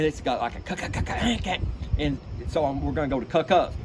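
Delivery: clipped "cuck, cuck"; hard guttural duck call; mid-range feed call. Shown as a demonstration. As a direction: mimic a closer feeding duck. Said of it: "what I call mid-range".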